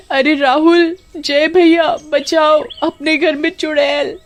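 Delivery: terrified screaming. Acted. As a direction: scream for help, slow and wailing. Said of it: "जोर जोर से चिल्लाने लगती है". (चुड़ैल देखकर डरी कामिनी, ऊँची घबराई चीख़ें)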